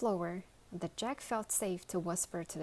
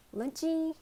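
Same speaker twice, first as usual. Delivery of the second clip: high, louder call through the door; 10 semitones higher, 5 dB louder than usual. Acted. Old woman calls lunchbox from corridor, calling out. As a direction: call her name from outside.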